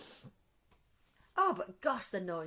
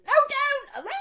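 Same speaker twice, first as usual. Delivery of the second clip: high, loud squealed protest; imitated teen squeal; 11 dB louder than usual. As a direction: squeal it like a teenager. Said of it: "proper screaming".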